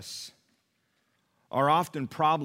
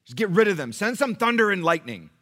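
high, loud preaching burst; preaching at full force; 9 semitones higher, 12 dB louder than usual. Acted. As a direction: call down the thunder with the congregation. Preacher rising again.

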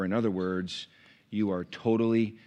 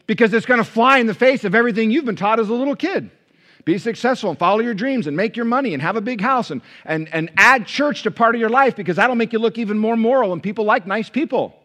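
high, loud, driving sermon cry; full-force preaching; 12 semitones higher, 11 dB louder than usual. Acted. Preacher urging his congregation, loud and high. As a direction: pound each line out to the crowd.

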